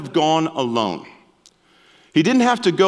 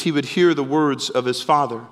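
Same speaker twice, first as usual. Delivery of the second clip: usual speaking voice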